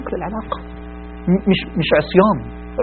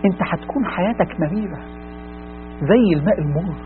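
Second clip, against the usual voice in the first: hushed warning; 2 semitones lower, 11 dB quieter than usual. Acted. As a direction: lean in and warn them almost under the breath. تحذير هامس منخفض. (تحذير خافت جدا وجاد)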